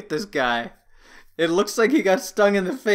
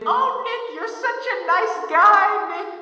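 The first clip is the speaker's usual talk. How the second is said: high, slow, singsong mock fawning; gushing fan impression; 10 semitones higher, much slower than usual. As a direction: coo like an adoring fan, drawn out and high-pitched. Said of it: "get all the positive comments saying".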